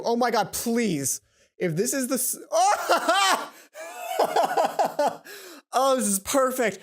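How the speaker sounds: loud, delighted squeal with a laugh; thrilled outburst; excited. Real, not acted. Player thrilled by the chapter screen, loud delight.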